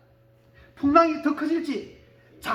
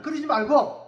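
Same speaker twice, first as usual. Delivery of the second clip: usual speaking voice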